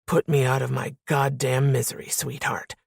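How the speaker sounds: smooth, deep, menacing. A narrator's reading. Man daring the woman he pins, smooth deep menace. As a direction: go deep and smoothly dare her. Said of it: "His voice was smooth, deep, menacing".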